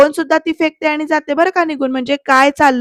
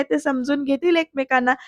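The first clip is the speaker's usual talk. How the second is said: usual speaking voice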